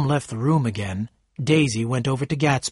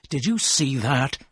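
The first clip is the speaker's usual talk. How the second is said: plain reading voice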